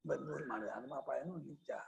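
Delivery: whispered reading to himself; whispered aside. Real, not acted. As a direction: drop to a whisper as if thinking aloud.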